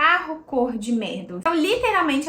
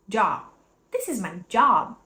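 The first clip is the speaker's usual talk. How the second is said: ordinary talk, nothing marked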